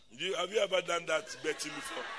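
ordinary delivery

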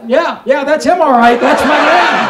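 loud, building mock cry; exclaiming wife. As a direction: play the wife exclaiming, building to a shout on the last words. Wife's punchline confirming it's him.

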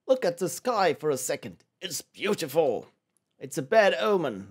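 theatrical, vaguely British game voices; poorly British characters. Acted. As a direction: act it out in a bad British accent. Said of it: "they all sound vaguely and poorly British".

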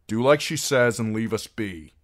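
firm warning, a little forceful. A narrator's reading. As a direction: warn them off with mild force. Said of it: "warned Gendry".

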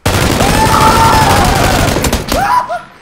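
high loud long laugh. Real, not acted. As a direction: cackle loudly.

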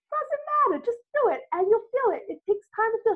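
high and bright, brushing it off; breezy upbeat Stacy. Retold or imitated. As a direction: wave away the doubt cheerfully. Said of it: "She goes".

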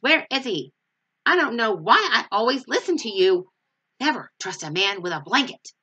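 loud, fed-up yell at Linus; angry yelling. Acted. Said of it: "Sally yelled at Linus".

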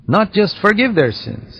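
ordinary delivery

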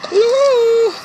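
sudden high whoop at a jump scare; startled whoop. Real, not acted. Gamer startled by the game, high whoop. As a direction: yelp it out, way up high.

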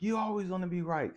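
high-pitched mimicked accusation; mock accusing whine; accusing woman. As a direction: imitate her complaint, pitch way up. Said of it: "she's like".

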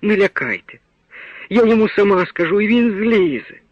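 high, pleading cry to the forester; frightened plea. A narrator's reading. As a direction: beg him to stop, voice climbing high. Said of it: "стала на захист свого хлопчика мати". (мати благає лісника високим наляканим голосом)

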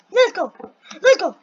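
high, loud bursts; excited cheer. Real, not acted. Player cheering as the jumps go well, excited cheer. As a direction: cheer yourself on in quick bursts.

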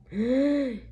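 startled high gasp; sharp high gasp. Acted. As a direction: gasp as if seeing the murder. Reacts to vision of a killing.

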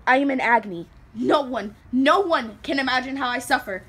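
loud, despairing outburst; anguished cry. Acted. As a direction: cry out the suffering at full voice. Anguished confession.

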